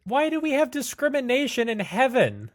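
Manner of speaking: high, loud incredulous protest; outraged complaint. Acted. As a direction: protest loudly at the injustice, pitch way up. Player exclaims at discrimination in heaven.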